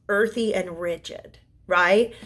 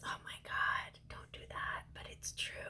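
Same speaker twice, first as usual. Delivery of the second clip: near tears, almost soundless, much softer; choked-up whisper; 19 dB quieter than usual. Real, not acted. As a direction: hold back tears in a breathless hush. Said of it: "Now I'm gonna cry".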